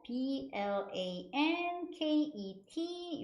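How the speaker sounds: slow, letter by letter; drawn-out separate letters; spelling out. Shown as a demonstration. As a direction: spell B L A N K E T for learners. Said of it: "요렇게 쓰고요".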